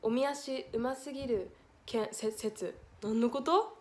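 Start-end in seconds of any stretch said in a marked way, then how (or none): none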